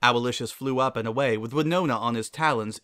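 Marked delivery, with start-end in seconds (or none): none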